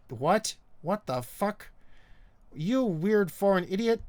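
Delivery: slowing into exasperated mock disbelief. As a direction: act out an interviewer's indignant bafflement. Retold interviewers' baffled reaction to his answer.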